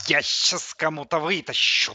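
loud lisped threat, "щас" full of hushing; lisping. A narrator's reading. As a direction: hiss the sibilants in "вытащу". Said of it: "прошепелявил наушниками плеер". (шепеляво и громко: «щас», «вытащу»)

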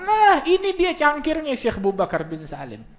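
high, stretched "here it is" cry; excited reveal. Acted. Wife showing her husband the sheikh's cup, excited. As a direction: present it triumphantly, pitch way up. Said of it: "Dia bilang".

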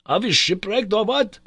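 startled professor asking in alarm; alarmed uncle. A narrator's reading. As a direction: demand it in fright. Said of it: "cried my uncle".

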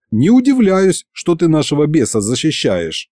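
ordinary delivery, nothing marked

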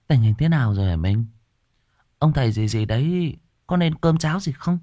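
a wife anxiously questioning her husband. A narrator's reading. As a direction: press him for news, fretting. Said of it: "chị sốt ruột".

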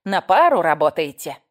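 loud, slow, drawn-out accusation; nasal old busybody; whining nasty granny. A narrator's reading. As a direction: stretch the words with smug suspicion. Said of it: "загундосила противная бабка".

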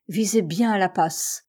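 plain reading voice

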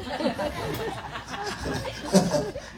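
a high burst of laughter. Real, not acted. Disciple joke lands. (cười giòn)

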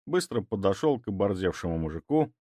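ordinary delivery, nothing marked

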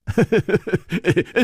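light, high run of chuckles; amused little laugh. Acted. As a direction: chuckle before explaining. Chu laughs explaining his friend.

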